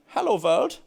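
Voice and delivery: hello world with a mock German accent, "world" said as "vorld". Shown as a demonstration. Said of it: "in a German accent".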